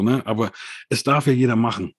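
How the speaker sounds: usual speaking voice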